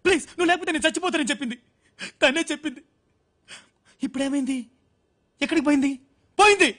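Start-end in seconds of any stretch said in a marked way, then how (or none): 6.4-6.7 higher and louder, an anguished outburst; jilted man crying out that she left; anguished shout. Acted. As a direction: hurl the word out in pain. Jilted lover raging about her.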